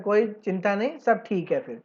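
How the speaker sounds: ordinary talk, nothing marked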